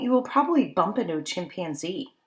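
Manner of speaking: ordinary delivery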